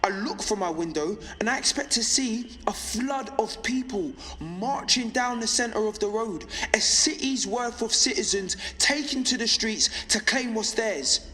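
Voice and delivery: rising, fervent high-pitched rallying speech; impassioned. Acted. Broadcaster picturing the uprising they expected in the streets, impassioned. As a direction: build insistently through each repeated expectation to a fiery peak.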